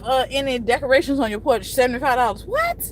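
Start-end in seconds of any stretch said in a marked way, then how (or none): 2.4-2.8 sharp high disbelieving rise; incredulous what. Real, not acted. Hearing about the porch fee, shocked high rise. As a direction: react as if the fee is unbelievable.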